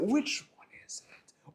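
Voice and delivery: breathy conspiratorial whisper to the crowd. Shown as a demonstration. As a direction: whisper it like a secret question. Asks, then repeats whispered.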